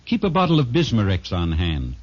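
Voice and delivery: ordinary delivery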